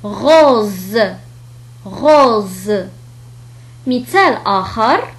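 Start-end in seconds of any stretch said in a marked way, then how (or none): 0.0-1.0 "غوز" ending on a voiced z; French r, long o, buzzing z in "غوز". Shown as a demonstration. Teacher showing the special case, z in "غوز". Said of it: "فينطق". 1.9-2.7 "غوز" ending on a voiced z; French r, long o, buzzing z in "غوز". Shown as a demonstration. Teacher showing the special case, z in "غوز". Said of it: "فينطق".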